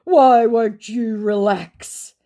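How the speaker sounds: high, loud, drawn-out plea; slow, exaggerated scammer voice; mock scammer. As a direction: drag it out as an exasperated scammer. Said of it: "said my scammer".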